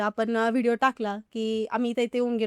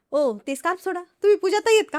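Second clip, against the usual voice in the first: excited call-out; 5 semitones higher, 4 dB louder than usual. Retold or imitated. Fan in market recognises her voice, excited call. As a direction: voice jumps high and loud on the question.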